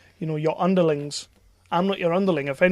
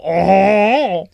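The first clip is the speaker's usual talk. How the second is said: put-on loud drawn-out "oh" in clumsy French accent; badly done French accent; 3 semitones higher, 7 dB louder, much slower than usual. Mocking Jason's attempt at French, exaggerated bad French accent. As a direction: overdo a poor French accent. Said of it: "that is how you do a French accent, not".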